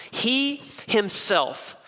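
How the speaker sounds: "He himself" said very slowly, each word weighted; stress on he himself. Shown as a demonstration. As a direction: drag out "He himself" for emphasis. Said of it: "he puts an emphasis".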